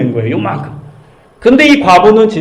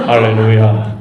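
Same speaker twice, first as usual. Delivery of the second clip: much lower, formal preacher tone; deep suit voice; 8 semitones lower, slower than usual. Shown as a demonstration. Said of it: "양복만 입으면 목소리가 깔아져요".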